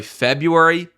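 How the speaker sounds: usual speaking voice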